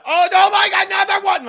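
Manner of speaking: high, loud shocked shriek of joy; shocked shout. Real, not acted. Streamer shrieking at a huge donation, high and loud. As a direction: screams oh my God at a big tip. Another big donation arrives.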